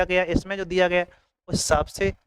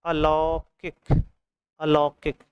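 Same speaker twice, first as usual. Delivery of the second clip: slow spelled-out word; much slower than usual. Shown as a demonstration. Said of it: "आ लगा दिया".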